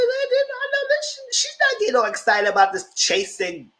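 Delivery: high, shocked gossip voice; high incredulous. Retold or imitated. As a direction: squeal it in disbelief. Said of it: "I said".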